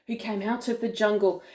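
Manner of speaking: ordinary delivery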